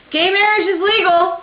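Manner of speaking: high, loud celebratory call-out; loud and clear. Retold or imitated. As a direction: yell it out to passers-by. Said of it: "she says loud and clear".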